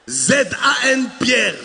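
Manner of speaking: slowly spelled Z A N Pierre, lisped; slushy lisp. Retold or imitated. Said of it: "tu zozotes". (épeler Z A N Pierre en zozotant)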